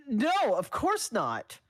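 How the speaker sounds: high, loud, slow squeaky protest; panicked denial. Acted. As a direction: deny it too loudly in a panic. Danny panics, denying.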